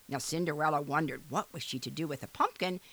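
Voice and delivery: ordinary delivery, nothing marked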